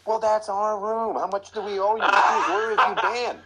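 con the delivery guy with a straight face; mock innocent. Rookie claiming the pizza from the delivery guy, hammed-up innocence. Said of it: "I go".

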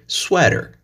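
Sweater with the r, swɛtə˞; American sweater. Shown as a demonstration. Teacher models the American word. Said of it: "In American English".

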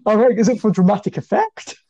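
high-pitched, laughing tease; amused teasing. Real, not acted. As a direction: tease them while still laughing. Amused teasing after the long pause.

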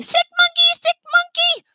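very high, loud chant of a tantrum; child yelling. A narrator's reading. Jane yelling her tantrum at the toy stroller. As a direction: stomp and shout like a sulky kid.

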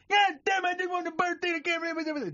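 very high, mangled protest; ranting garbled customer. Acted. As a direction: rant unintelligibly on the way out. Customer storms out again in joke.

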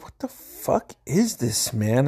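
mock baffled cousin, drawn out. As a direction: stretch it out in disbelief, slowly. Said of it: "I just heard Cousin Dave".

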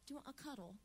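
light mock British accent, soft and coy; a hint of a British reality-show contestant. Host mimicking British dating-show contestants, light British accent. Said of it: "just because they have the accent".

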